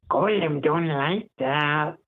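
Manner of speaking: slow, strained Gollum rasp. Shown as a demonstration. Voice actor recalls mimicking Gollum in the movie theatre, slow and creaky. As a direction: creep the words out like Gollum. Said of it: "Gollum came on".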